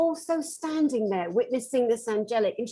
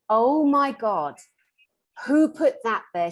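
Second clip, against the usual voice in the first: slow, louder, appalled exclamation; retold shock; 6 dB louder than usual. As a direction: relive the horrified discovery, drawing it out. Retold shock at finding pots.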